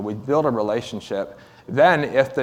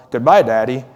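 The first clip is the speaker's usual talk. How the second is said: put-on louder call of a waving boy; called out goodbye; 3 semitones higher, 10 dB louder than usual. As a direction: call it across to daddy. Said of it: "waved a hand and called".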